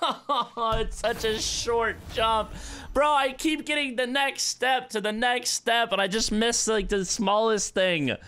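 high, drawn-out wail of frustration; whining dismay. Real, not acted. Gamer just missed the smallest jump, whining dismay. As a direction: moan it high and slow, close to a whine. Complains after failing the jump.

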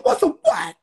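high incredulous what; disbelief. Retold or imitated. Hearing the suspect is a juvenile, in shock. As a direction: snap back in disbelief. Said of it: "I said".

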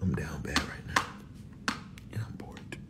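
hushed whisper to the camera. Real, not acted. Whispering her plans to the viewers, hushed. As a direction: breathe it as a secret.

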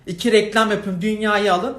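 loud mocking tirade; sarcastic angry rant. Real, not acted. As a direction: spit sarcasm at the rich, loudly.

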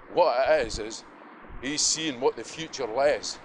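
ordinary talk, nothing marked

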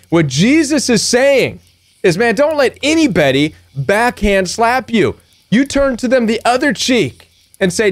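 ordinary talk

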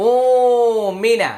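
উ held long, then short মিনা; one-alif stretch. Shown as a demonstration. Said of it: "উ হচ্ছে এক আলিফ টান".

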